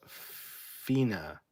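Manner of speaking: "fina" stretched out as FEE-nuh; drawn-out Italian-style "fina"; pronounced "fina". Shown as a demonstration. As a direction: say "fina" slowly, two drawn-out syllables. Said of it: "Fine is pronounced".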